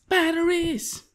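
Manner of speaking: high, loud squeal of delight; excited squeal. Real, not acted. Gamer thrilled to find batteries, high squeal. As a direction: shriek it with glee.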